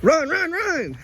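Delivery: high urgent yells; excited shouting. Real, not acted. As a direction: shout it out in a rush. Fish hooked, urging to run.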